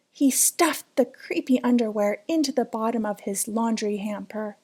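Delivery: usual speaking voice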